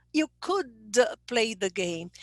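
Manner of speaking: "could" followed by a little "uh" before "play"; intrusive schwa. Shown as a demonstration. Teacher showing the student's struggle, intrusive schwa. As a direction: let a schwa slip out after "could". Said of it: "a little schwa gets in the way".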